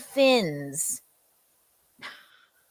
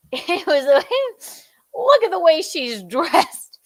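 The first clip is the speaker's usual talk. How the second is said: giggly, higher voice; laughing while talking; 9 semitones higher than usual. Real, not acted. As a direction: laugh through the words.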